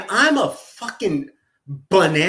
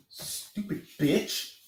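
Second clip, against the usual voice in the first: muttering; 2 semitones lower, 8 dB quieter than usual. Real, not acted. Muttering insults about Jules.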